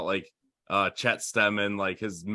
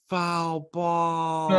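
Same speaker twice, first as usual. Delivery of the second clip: umpire call; 8 semitones higher than usual. Retold or imitated. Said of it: "the umpire's like".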